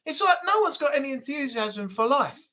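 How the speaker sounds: ordinary talk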